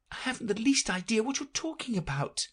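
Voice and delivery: put-on high, baffled little girl; Alice's voice. Alice puzzled by the pigeon, high baffled voice. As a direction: answer the bird confused.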